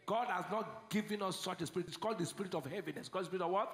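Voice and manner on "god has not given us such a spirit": usual speaking voice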